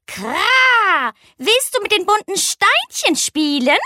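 hohe Rabenstimme mit Kra-Ruf; krächzender Rabe. Acted. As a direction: frag hoch wie ein freundlicher Rabe. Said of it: "und fragte". (der Rabe lockt die Eule zum Spielen, hoch krächzend)

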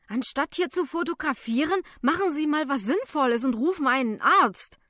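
loud, high, urgent rebuke; indignant scolding. A narrator's reading. Helper scolds photographers to call a doctor, indignant. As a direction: tell off the gawkers loudly. Narrator scolds gawking passengers.

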